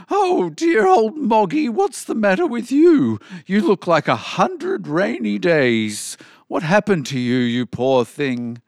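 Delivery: high, loud, fussing donkey voice; sympathetic donkey. A narrator's reading. Donkey fusses over unhappy cat.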